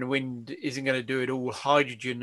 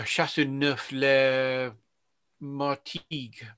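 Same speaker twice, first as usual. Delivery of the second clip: slow, drawn-out French place name; French accent; much slower than usual. Retold or imitated. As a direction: say it the French way, slowly. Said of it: "Your French accent is".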